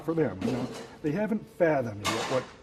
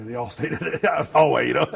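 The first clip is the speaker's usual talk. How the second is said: laughing; 3 semitones higher, 8 dB louder than usual. Real, not acted. Laughing at family staying out.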